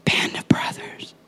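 whispered refrain, unhurried. Acted. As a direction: breathe the phrase out like a secret.

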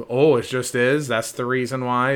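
acted scornful disbelief; mock incredulous challenge. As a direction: throw it back as a disbelieving question.

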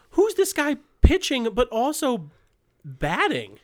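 high, amazed disbelief; baffled surprise. Retold or imitated. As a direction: gasp out the question in wonder. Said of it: "I'm like".

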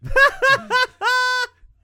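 howling high laugh; roaring laugh at a friend's joke; loud burst of laughter. Real, not acted. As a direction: crack up hard and loud.

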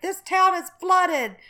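milk the disaster like a TV reporter; hammed-up storm reporter, standing in the water; dramatic reporter. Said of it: "he's like".

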